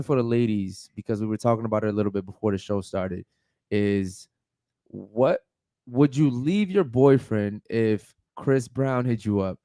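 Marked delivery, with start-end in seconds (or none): none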